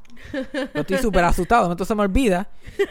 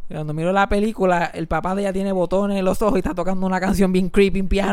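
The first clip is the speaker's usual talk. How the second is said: ordinary talk, nothing marked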